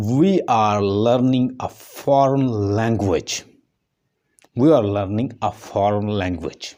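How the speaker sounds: each word drawn out slowly for analysis; slow demo sentence. Shown as a demonstration. Teacher slowly reading an example sentence to the class. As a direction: spell out the sentence slowly so students hear its parts. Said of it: "एक sentence है जो मैं आपके सामने बोलता हूँ".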